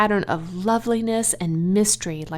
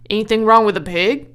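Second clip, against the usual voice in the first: louder, quick concerned question; fast worried farmer; 8 dB louder, faster than usual. Acted. Mr. Zuckerman questions Lurvy, quick and worried. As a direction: press him, worried.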